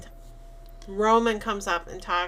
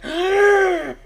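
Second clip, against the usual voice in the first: gasp, then a high, loud, horrified question; a mother recoiling from her baby in shock; horrified cry; 7 semitones higher, 8 dB louder than usual. Retold or imitated. Rosemary seeing the baby's eyes, horrified shout.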